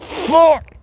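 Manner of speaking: very high loud cry of despair; anguished curse. Retold or imitated. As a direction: howl at the rejection. Rejected by her.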